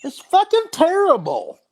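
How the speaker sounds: high-pitched, incredulous ranting; exasperated rant. Real, not acted. Host ranting to his co-hosts about the doubters, exasperated. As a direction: rant in disbelief, voice pushed way up high.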